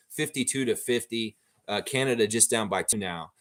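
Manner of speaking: usual speaking voice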